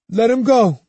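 usual speaking voice

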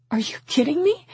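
high, incredulous question; indignant huff. A narrator's reading. Mother to officer suggesting runaway, indignant huff. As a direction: pitch it up in outraged disbelief. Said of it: "I huffed".

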